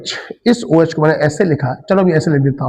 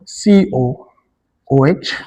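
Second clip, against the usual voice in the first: ordinary delivery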